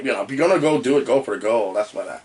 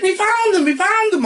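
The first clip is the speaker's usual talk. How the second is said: high excited repeat; mock excited doctor; 4 dB louder than usual. Host voicing the doctor finding him, high excitement. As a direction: announce it, high and thrilled. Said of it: "He's like".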